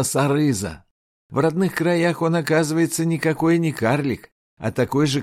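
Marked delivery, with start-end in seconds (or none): none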